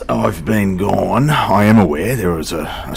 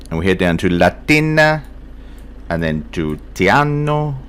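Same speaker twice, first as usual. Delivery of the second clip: mock Italian accent. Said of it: "sounded vaguely European, that accent".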